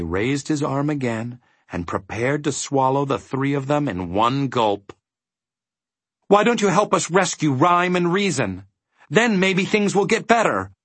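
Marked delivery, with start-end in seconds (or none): 6.3-10.7 high, urgent shout. A narrator's reading. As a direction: call it out urgently, pitched well up. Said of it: "shouted Milo again".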